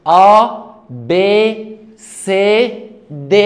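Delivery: "A B C D" called out loud in French; loud French alphabet. Shown as a demonstration. Teacher recites "A B C D" loudly for listeners. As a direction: announce each French letter clearly. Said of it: "اسمعني دلوقتي".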